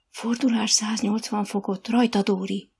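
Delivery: usual speaking voice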